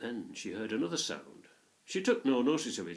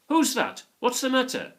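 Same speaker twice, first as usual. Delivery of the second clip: called out loud and much higher, worried; anxious calling; 9 dB louder than usual. A narrator's reading. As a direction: call across the hillside, alarmed. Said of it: "an anxious voice calling".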